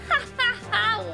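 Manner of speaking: high loud laughing; gleeful laugh. Real, not acted.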